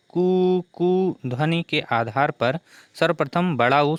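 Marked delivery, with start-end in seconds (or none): 0.1-0.6 mock crow of a rooster; rooster call. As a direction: imitate the rooster's कू. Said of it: "मुर्गे की प्रातःकालीन कू".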